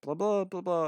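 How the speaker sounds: teasing mock shout of blah blah. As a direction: shout the nonsense over everyone, half in jest. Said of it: "I shout".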